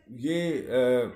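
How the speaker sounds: normal talking voice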